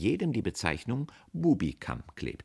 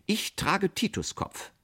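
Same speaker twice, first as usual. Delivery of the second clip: high, slow, stately announcement; self-important declaration; 13 semitones higher, slower than usual. A narrator's reading. Aunt naming her short haircut, grandly.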